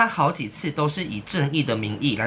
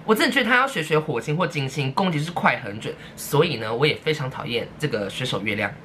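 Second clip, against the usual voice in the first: normal talking voice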